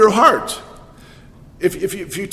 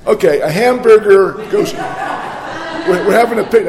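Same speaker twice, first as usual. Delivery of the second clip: laughing; 2 semitones higher, 5 dB louder than usual. Real, not acted. Laughs through botched joke start.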